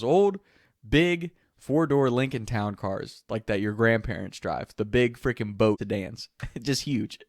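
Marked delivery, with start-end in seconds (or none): none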